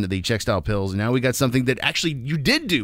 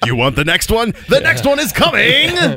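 booming DJ announcer voice; big hype radio announcer; 11 semitones higher, 7 dB louder than usual. Retold or imitated. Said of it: "He put on his radio voice".

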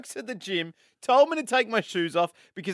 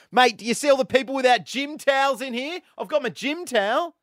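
indignant comeback; 9 semitones higher than usual. Retold or imitated. Host rehearsing his comeback to the gym staffer, indignant and high. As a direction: act out an outraged retort, pitched up.

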